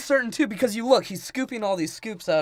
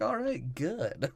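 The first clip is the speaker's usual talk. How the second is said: mild quiet grunt; 11 dB quieter, much slower than usual. Acted. Voiced reply in movie riff.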